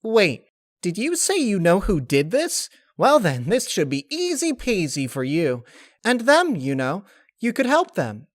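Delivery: high, loud and bubbly; very high excited spirit voice; excited Laughter. Acted. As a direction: bounce with sudden excitement.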